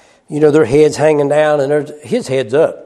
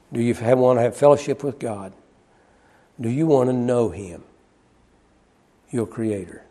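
soft altar-call appeal; 4 semitones lower, 6 dB quieter than usual. Real, not acted. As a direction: draw them in gently with each question.

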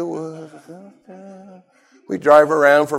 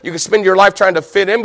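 ordinary talk, nothing marked

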